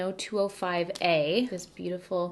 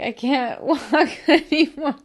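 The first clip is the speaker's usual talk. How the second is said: laughing; 6 semitones higher, 8 dB louder, slower than usual. Retold or imitated. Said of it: "I'm like".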